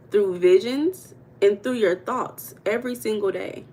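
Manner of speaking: ordinary talk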